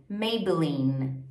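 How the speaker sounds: "Maybelline" slowly, held N; final N. Shown as a demonstration. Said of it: "o último som é da letra N".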